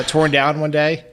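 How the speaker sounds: ordinary delivery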